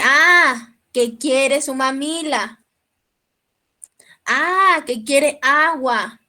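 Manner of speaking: slow mother's "Ah" rising to realization; mother guessing. Retold or imitated. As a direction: spell out the baby's wish like a mom figuring it out. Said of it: "Y la mamá dice". (mamá adivinando, lenta, con "ah" de descubrimiento)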